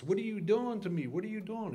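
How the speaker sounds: high, panicked yell at the instructor; retold scream. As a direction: shout it high in mid-air panic. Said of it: "I'm screaming at the top of my lungs".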